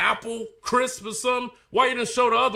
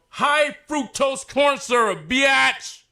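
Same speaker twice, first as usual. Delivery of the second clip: higher, louder, crowing over the find; triumphant gotcha; 7 semitones higher, 3 dB louder, slower than usual. Real, not acted. Showing viewers the label, gloating and excited. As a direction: slap it down like proof.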